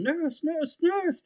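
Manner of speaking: high-pitched, held-back mock call. Freaking out, calling to the nurses, calling out. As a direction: repeat the call urgently, up high. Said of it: "So I'm calling out to them".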